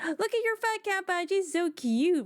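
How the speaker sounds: high gushing gasp over a cute badge; excited gasp. Real, not acted. Streamer gushes to chat about the badge, excited high squeal.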